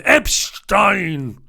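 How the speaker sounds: exaggerated loud German pronunciation; mock German, harsh and loud; guttural German. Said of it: "that guttural pronunciation of it, unmistakably German".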